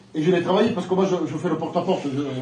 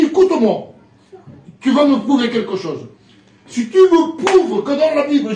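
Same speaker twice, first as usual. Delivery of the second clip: louder, insistent, slowing at the end; challenging; 6 semitones higher, 7 dB louder than usual. Retold or imitated. Neighbour challenging the preacher in the street, insistent. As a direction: demand proof, forcefully.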